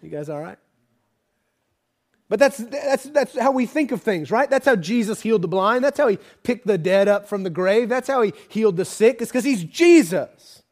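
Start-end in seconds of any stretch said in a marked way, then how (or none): 2.3-10.6 loud, high preaching build-up; full-force preaching. Acted. Preacher rousing the congregation, loud and high.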